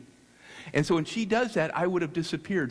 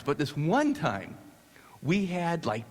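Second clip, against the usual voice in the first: normal talking voice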